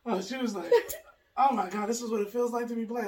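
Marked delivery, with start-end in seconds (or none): 1.4-3.1 laughing. Retold or imitated. Retold friend's reaction.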